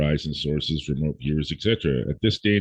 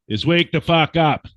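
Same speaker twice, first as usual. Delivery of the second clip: higher, louder shouted command; angry wake-up call; 9 semitones higher, 6 dB louder than usual. Real, not acted. Ranting host yells at complacent listeners, loud and higher. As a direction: yell it to jolt them awake. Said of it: "and why I'm talking like this".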